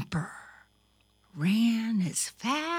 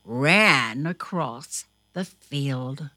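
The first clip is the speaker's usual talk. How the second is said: plain reading voice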